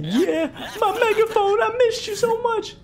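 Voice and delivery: very high, slow squeaky voice for the overjoyed ghost; happy ghost voice. Pretend. Voicing Morty reunited with his megaphone, squeaky and slow. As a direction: hold each word up high like a giddy child. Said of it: "He's like".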